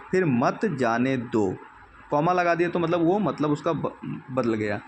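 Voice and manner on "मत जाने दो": मत जाने दो, slow and deliberate; slow clause after the comma. Shown as a demonstration. Teacher reading मत जाने दो to the class slowly after the comma. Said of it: "comma लगाया".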